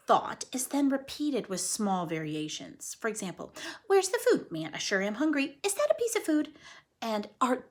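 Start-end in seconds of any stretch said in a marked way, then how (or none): 3.9-6.5 higher, eager hungry squirrel. Acted. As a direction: chirp it like a starving squirrel. Squirrel thoughts voiced.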